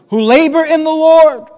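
"labor in the Lord" high, loud and sustained; emphatic "labor in the Lord". Shown as a demonstration. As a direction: proclaim "labor in the Lord" at full voice. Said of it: "Notice the emphasis".

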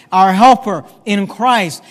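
"helper" hit loud and stretched out; slow down and punch "helper"; emphasis on "helper". Shown as a demonstration. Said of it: "Notice the emphasis".

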